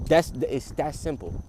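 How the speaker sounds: ordinary talk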